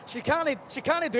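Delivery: mock Scotty shout in Scottish accent; bad Scottish accent. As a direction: have a go at a pleading Scottish engineer. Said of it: "so Scotty could shout".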